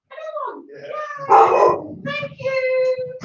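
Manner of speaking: distant, high sing-song thanks; off-mic doorstep greeting. Real, not acted. Vlogger answering the postman at the door, high, distant sing-song. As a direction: greet a caller in a high lilt from across the room.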